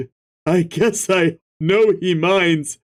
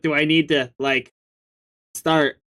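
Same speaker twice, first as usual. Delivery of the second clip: usual speaking voice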